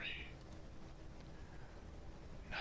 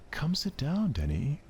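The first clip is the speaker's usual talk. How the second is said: high, bright call to sit; eager invitation; 9 semitones higher, 8 dB louder than usual. Acted. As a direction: coax him over cheerfully.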